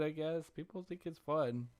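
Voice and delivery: ordinary talk, nothing marked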